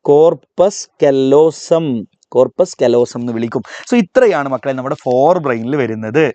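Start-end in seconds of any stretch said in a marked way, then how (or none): 0.0-1.9 slow and clear for the class to repeat; slow model answer. Shown as a demonstration. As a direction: spell the term out slowly, teacher modelling it. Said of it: "ഉറക്കെ പറഞ്ഞേ".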